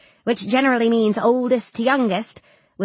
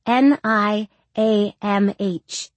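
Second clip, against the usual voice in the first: spelling out slowly; much slower than usual. A narrator's reading. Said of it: "Pronounced Niamh".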